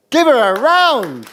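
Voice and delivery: loud, high and slow call for applause; big host call to clap; rallying applause. Real, not acted. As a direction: whip up the crowd for a round. Asking the audience to applaud.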